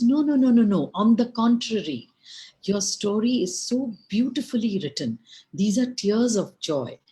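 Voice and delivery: loud, high rush of denial, easing slightly; emphatic reassurance. A narrator's reading. Valmiki reassuring Hanuman his story is beautiful, emphatic reassurance. Writer hastily reassures the apologetic monkey.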